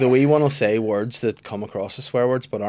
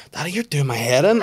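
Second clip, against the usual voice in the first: higher, louder, copying a grown-up; little daughter; 5 semitones higher, 5 dB louder than usual. Retold or imitated. Young daughter scolding her daddy, mock adult. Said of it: "I was like".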